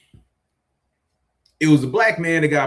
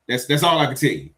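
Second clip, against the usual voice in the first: usual speaking voice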